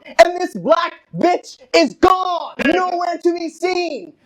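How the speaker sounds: loud, high, drawn-out exclamation; indignant outburst. Retold or imitated. Comic telling the crowd the guy vanished, indignant outburst. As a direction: belt it out slowly in outrage. Indignant punchline about stolen shirt.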